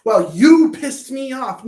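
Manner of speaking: slow, loud, accusing you pissed me off; hammed-up slow angry line; mock angry retort. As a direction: snap it back in mock fury. Quoting angry person.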